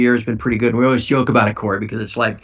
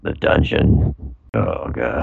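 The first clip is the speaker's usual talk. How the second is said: much lower, gloomy dungeon voice; mock depressed voice; 11 semitones lower, much slower than usual. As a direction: drop into a hammed-up depressed drone. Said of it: "He's so depressed".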